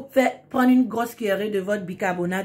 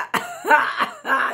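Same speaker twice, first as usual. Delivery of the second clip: laughing. Real, not acted. Laughs at mixing up words.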